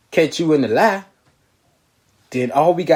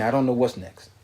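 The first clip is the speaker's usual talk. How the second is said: ordinary talk, nothing marked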